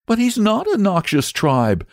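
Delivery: put-on high, upset young boy; distressed boy. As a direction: protest in dismay. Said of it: "said the boy distressedly".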